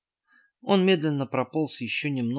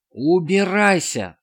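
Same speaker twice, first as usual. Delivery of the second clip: loud, slow, high yell; 8 semitones higher, 7 dB louder, slower than usual. A narrator's reading. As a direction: scream him away. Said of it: "орал Эд".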